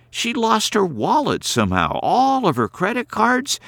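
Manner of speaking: put-on a brisk young woman scolding gently; Carrie's voice. A narrator's reading.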